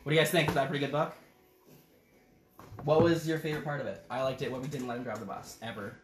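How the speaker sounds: ordinary delivery, nothing marked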